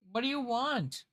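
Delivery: high cooing question. Real, not acted. Talking to the cat.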